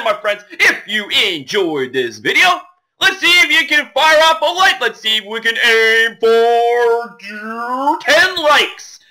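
hyped host outro, words stretched out; rally the viewers with big, drawn-out flourishes; punchy, swooping YouTuber outro; showy video sign-off. Acted.

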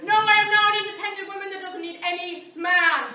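loud proud declaration; defiant proclamation. Acted. Wife refuses help and leaves him, loud defiant proclamation. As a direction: proclaim your independence.

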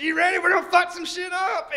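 high, loud pumped-up yell; hyped shout. Retold or imitated. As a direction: rile yourself up for chaos. Hyped guy charging off.

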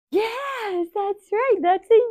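high, loud yes that's right; excited praise. Real, not acted. Teacher cheering the kids' right answer, high and loud. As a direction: cheer them on.

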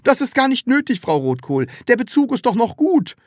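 modestly waving off an offer; modest protest. A narrator's reading. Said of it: "wehrte Herr Taschenbier bescheiden ab".

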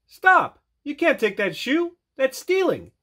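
high, urgent reproach; scolding dragonfly. A narrator's reading. Magnus to Snake stealing the shoe, high scolding voice. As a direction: tell him off, high and firm.

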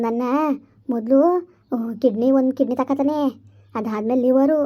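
low, eerie ghost warning of the trafficker. Acted. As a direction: speak low like a knowing ghost. (ತಗ್ಗಿದ ದೆವ್ವದ ಧ್ವನಿ)

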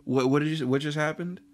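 hammed-up baffled disbelief; lost, confused. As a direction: act totally thrown, stumbling over questions. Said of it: "so fucking, like, lost".

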